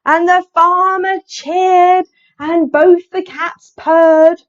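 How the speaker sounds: high, loud, slow build; big, animated read-aloud; triumphant storytelling. A narrator's reading. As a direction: stretch out the happy ending. Story builds to happy ending.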